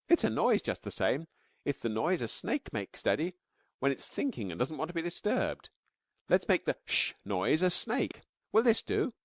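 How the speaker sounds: put-on high, bright-eyed child voice, eager; little girl Taffy. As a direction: play a small girl explaining her drawing.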